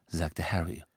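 plain reading voice

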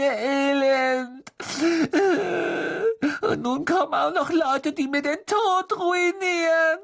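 self-pitying wail, very high and drawn out; wailing ghost girl.

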